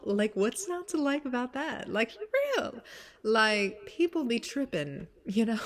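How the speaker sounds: high, loud and animated; excited. Real, not acted. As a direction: really push it, thrilled. Rising excitement.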